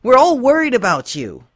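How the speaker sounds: high, loud, desperate apology; high, loud, pleading pony; pleading Rainbow Dash. A narrator's reading. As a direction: beg her friend not to vanish.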